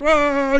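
high-pitched mock scream, only moderately loud; imitated scream. Film fan imitating the hero screaming in his headset, mock scream. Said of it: "He's screaming in his headset".

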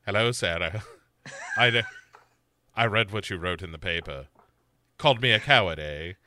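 low, measured killer voice; imitating serial killer. Killer phoning Sarah from jail, low imitated voice. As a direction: play the jailed killer down low, with pauses. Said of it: "And he's like".

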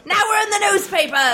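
high, excited newspaper hawker; old-timey newsboy. Pretend. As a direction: crow it out like an old-time newsboy. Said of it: "talk in an old-timey voice".

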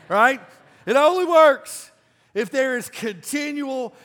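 high, loud, pounding the point home; shouted sermon climax; preaching at full force. Acted. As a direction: preach it out to the back row.

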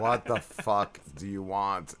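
slow, drawn-out mock exasperation; exasperated retort. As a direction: drag each word out, fed up. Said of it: "I'm just like".

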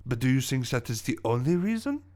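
put-on insinuating French-accented question. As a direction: lead into a reveal in a French accent. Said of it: "my French accent".

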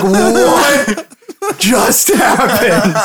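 laughing, drawn-out astonishment; stunned crowd. Acted. Onlookers gasp at the kiss, stunned. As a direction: stretch it out in mock shock. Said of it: "there's a just an audible gasp".

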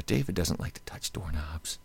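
hushed whisper of a handler; whispered aside. Retold or imitated. Host retelling a handler's warning, whispered. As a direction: whisper it like a secret.

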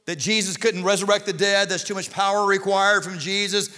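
forceful, projected pulpit voice; full-force preaching. Real, not acted. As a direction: preach it hard to the whole room.

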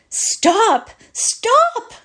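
sharp, high, loud cry; cried out. Acted. Miss Meadows cries out to halt the choir. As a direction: stop the singing at once. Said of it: "cried Miss Meadows".

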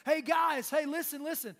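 loud, high call-out; yelling from afar. Retold or imitated. Guy on the shore calling fishermen in a boat, yelling. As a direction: holler to get the group's attention. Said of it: "yelling from the shore".

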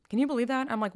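ordinary delivery

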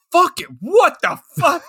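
high, sudden mock shock; shocked outburst. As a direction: blurt it out in shocked disbelief. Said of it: "you're like".

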